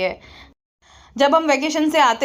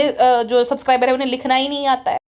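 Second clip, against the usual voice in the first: ordinary talk, nothing marked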